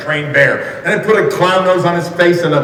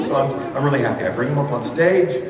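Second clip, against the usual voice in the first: usual speaking voice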